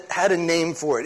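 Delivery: normal talking voice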